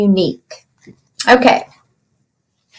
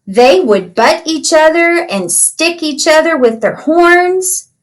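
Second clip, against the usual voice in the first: storybook reading; slower than usual. Acted. Teacher reads picture book aloud.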